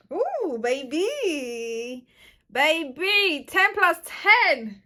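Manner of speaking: high, loud, slow gushing; delighted cry. Real, not acted. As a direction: hype your own look. Admiring finished look.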